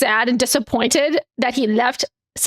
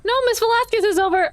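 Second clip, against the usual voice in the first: quick, higher flustered blurt; flustered rush; 9 semitones higher, much faster than usual. Acted. Player correcting the GM, flustered quick blurt. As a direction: rush it out, caught off guard.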